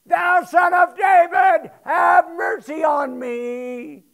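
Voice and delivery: the beggar drowns out the crowd with a slow desperate shout. Retold or imitated. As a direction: bellow it out, desperate for mercy. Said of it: "He cried the more a great deal".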